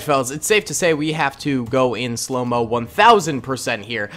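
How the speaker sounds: ordinary delivery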